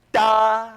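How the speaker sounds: loud punched high Da; accented da. Shown as a demonstration. Director shows leaning into accents. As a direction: hit the accent hard. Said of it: "lean into your accents".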